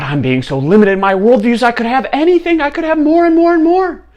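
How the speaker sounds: high, loud, climbing to a squeal; mounting greed. Acted. King dreaming of endless wishes, frenzied greed.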